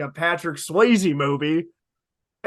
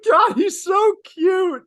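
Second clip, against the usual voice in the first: high, slow squeal of adoration; gushing; 13 semitones higher, 4 dB louder, slower than usual. Real, not acted. Friend fawns over the cool guy, gushing. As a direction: gush loudly through giggles.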